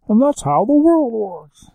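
high, drawn-out, loud silly voice; storybook everyone-else voice. Acted. Dad telling his baby stories, silly high character voice. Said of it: "make everyone else in the world talk like that".

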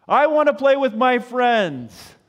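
put-on high, loud childish protest; whiny kid. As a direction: complain like a kid who wants to play. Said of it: "the kids are going".

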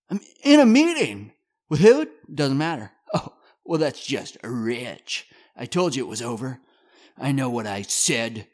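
loud, high, pushy phone call; aggressive. Acted. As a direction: snap into the phone. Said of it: "Rich, aggressive".

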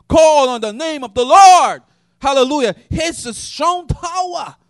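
loud, ringing pulpit preaching with rising calls; full-force preaching. Acted.

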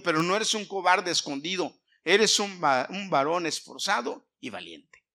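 ordinary talk, nothing marked

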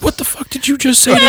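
very high, strained mock shout; mock angry threat. Barber scaring the kid with a gun, mock angry shout. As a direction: act out an outraged challenge to scare someone.

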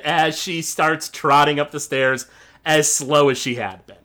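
normal talking voice

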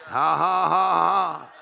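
loud, drawn-out ha-ha; demonstrated laughing. Shown as a demonstration. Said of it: "You need to practice that".

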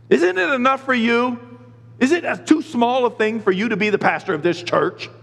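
projected rebuke. Shown as a demonstration. God as the whining pastor misread him, scolding. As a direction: press it as an exasperated challenge. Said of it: "That's the way I was reading that".

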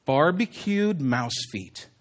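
low, soft and slow; mock idea reveal. One of us pitching a brilliant idea, hushed. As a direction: unveil it with hushed weight.

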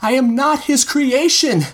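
high, slow cry, voice breaking; voice cracking. A narrator's reading. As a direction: cry back in desperate denial. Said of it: "cried back, voice cracking".

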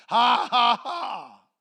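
booming mock laugh, easing off at the end; Brother Hagin laughing. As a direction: throw big forced ha-has back at the devil. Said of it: "He laughed and laughed".

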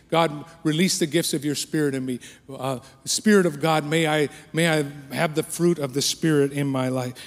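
loud, rising prayer cries to the Spirit; fervent prayer. Shown as a demonstration. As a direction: call out to God with longing. Prayed aloud at full force.

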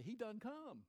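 a folksy Southern "He done come and gone"; Southern dialect. Pretend. Preacher dropping into Southern dialect for the punchline. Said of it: "in my best Southern dialect".

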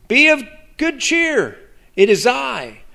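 high, lilting greeting; cheery reassurance. Acted. Jesus to the trembling disciples, high cheery reassurance. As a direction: call out high to reassure them.

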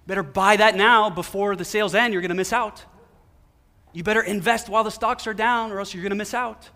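mock salesman pressing the crowd to buy now; mock pushy sales voice. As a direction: push them to buy like a pushy ad. Voicing culture's anxious money messages.

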